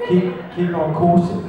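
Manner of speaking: lightly put-on English accent; mock English accent. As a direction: imitate the phrase in a tourist's English accent. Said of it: "copying the phrase".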